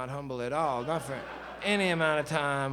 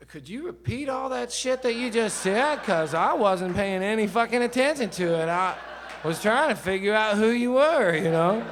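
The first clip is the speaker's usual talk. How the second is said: higher and louder, half-laughing demand; exasperated loud retort; 7 semitones higher, 10 dB louder than usual. Retold or imitated. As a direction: blurt it out in disbelief. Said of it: "I said".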